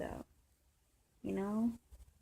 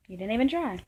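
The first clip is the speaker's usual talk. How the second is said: louder, slightly indignant retort; mildly angry reproach; 3 semitones higher, 8 dB louder than usual. Retold or imitated. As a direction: retell it with a touch of anger. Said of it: "I'm a little bit angry at her".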